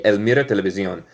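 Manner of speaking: ordinary delivery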